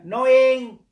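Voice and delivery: KNOWING called out high and loud; stress on knowing. Shown as a demonstration. Teacher calls Knowing to students, high and loud. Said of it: "teacher stresses the word".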